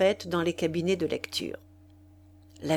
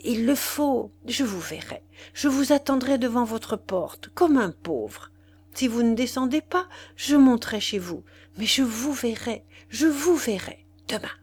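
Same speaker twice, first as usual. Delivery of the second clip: plain reading voice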